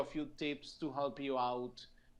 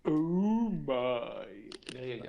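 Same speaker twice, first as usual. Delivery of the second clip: George Takei voice; 3 semitones lower, 4 dB louder, slower than usual. Shown as a demonstration. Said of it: "lower your voice in order to respect the wonderful George Takei".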